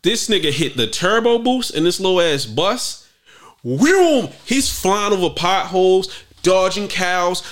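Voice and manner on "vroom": loud high car-engine vroom. Pretend. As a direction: shout a turbo-boost vroom. Imitating speeding bus.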